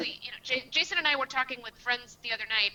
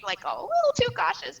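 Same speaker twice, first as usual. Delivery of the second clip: teasing, with a big smile; smiling; 11 semitones higher, 5 dB louder than usual. Real, not acted. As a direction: say it kindly, smiling, pitch jumping up. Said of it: "I'm gonna smile as I say that".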